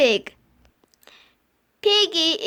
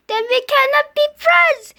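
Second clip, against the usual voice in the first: dramatic sorrow; 7 semitones higher, 6 dB louder than usual. Acted. Dramatic farewell.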